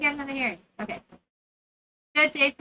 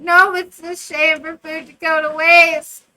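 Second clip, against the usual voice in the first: loud, slow, emphatic; emphatic line read; 4 semitones higher, 13 dB louder than usual. Acted. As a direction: sell the line loudly and slowly. Reading own dialogue choice.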